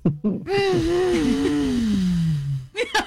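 gemido largo que va cayendo; sonido de bachata. Shown as a demonstration. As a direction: gime largo, como bailando bachata. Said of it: "le tendrías que poner un sonido". (quejido largo y sensual)

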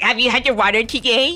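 mock husband asking about water; imitating husband. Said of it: "he's like".

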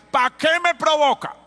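high, loud mock snap of an angry parent; angry retort. Preacher mimics a stubborn parent snapping at the child, loud and high. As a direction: fire it back defensively.

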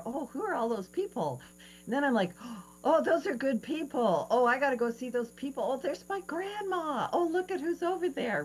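high, loud, eager excitement; excited. Retold or imitated. Woman spotting her grandma across the bridge, high eager excitement. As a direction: rush toward grandma with glee.

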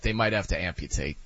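low, soft deadpan; deadpan mock concern. Fan watching players writhe in pain, deadpan mock concern. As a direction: mutter the overblown diagnosis drily. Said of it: "And I'm like".

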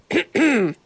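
deliberate little "ahem"; throat clearing. Acted. Bandit trying again, staged throat clearing. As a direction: clear your throat before a big line. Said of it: "cleared his throat and tried again".

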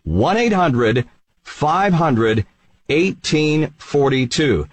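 number read out slowly with pauses between groups; slow, deliberate. Real, not acted. Host repeating the number so listeners can write it down, slowly. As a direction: space out each group of digits. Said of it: "I'm gonna say it slowly".

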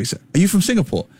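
a touch of eager excitement, voice lifted; excited. Retold or imitated. Tourist turning round to fellow Singaporeans on a bus, excited. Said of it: "and I get so excited".

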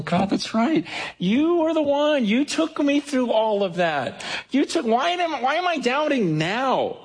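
tearful prayer. Retold or imitated. Preacher reliving his prayer to God, close to tears. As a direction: pour your heart out to God, voice lifting high. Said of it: "I would just start crying".

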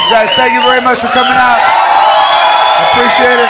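loud, high sign-off shout; shouted goodbye. Real, not acted. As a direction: shout thanks to the crowd. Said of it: "closing to audience, shouted".

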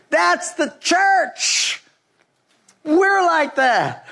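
high, slow, laughing aside; half laughing, drawn out; amused realization. Real, not acted. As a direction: let the joke land with a laugh. Laughing realization about the church.

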